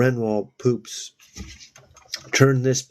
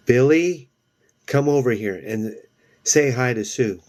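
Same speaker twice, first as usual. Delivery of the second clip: calling out; 2 semitones higher than usual. Acted. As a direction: call him over from the back. Chris calls Billy over.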